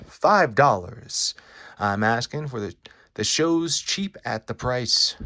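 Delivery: loud, showy huckster spiel stretching the big words; carnival barker. Acted. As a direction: sell the show to a passing crowd. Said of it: "a carnival barker".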